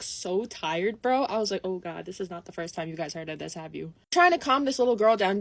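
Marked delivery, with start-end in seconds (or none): 1.6-3.9 soft, low aside; quiet dawning realisation. Retold or imitated. Seeing the tired store employees, she realises, in a low aside. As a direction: drop right down, as if to yourself.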